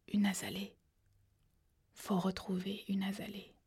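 chuchoté sans voix, égaré; un mourant qui divague dans un souffle; murmure délirant. A narrator's reading. (le frère mourant divague, en chuchotant)

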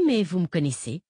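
usual speaking voice

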